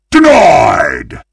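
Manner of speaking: loud, high triumphant shout; big gloating game call-out. Acted. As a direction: shout it like slamming a door on a rival.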